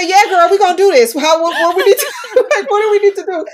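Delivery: high, loud, laughing through the words; giddy yes with laughter bubbling over; laughing excited yes; laughing excitedly. Retold or imitated. Excited retold reply, laughing.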